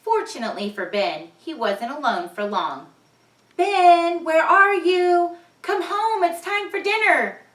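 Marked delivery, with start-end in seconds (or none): 3.6-7.4 high, loud call across the woods. Acted. Mom calling Ben home for dinner, high and loud.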